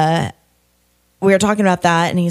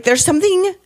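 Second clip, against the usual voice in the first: high, alarmed mock panic; retold panic; 7 semitones higher than usual. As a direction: replay the moment of alarm, pitched high. Retold panic about something in shirt.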